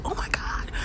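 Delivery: breathless whisper of disbelief; new mother whispering in shock, hushed; whispered awe. Retold or imitated. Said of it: "I was like".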